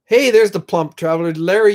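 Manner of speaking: ordinary talk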